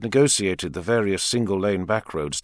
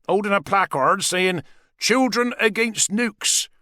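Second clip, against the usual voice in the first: higher, wry father telling a story; father character; 11 semitones higher than usual. A narrator's reading. Dave tells Richard about protest holidays, wry and higher. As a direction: spin an old yarn, dry and amused.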